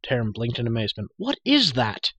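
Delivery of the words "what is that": high, loud astonished question; amazed cry. A narrator's reading. Prisoner blinking at the glowing sphere, amazed cry. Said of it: "he cried".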